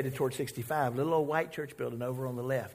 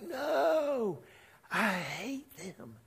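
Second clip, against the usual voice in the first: sulky Jonah; 7 semitones higher, 4 dB quieter, much slower than usual. Retold or imitated. Jonah refuses God's command, high then slow and sullen. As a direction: refuse like a stubborn child. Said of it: "And Jonah's like".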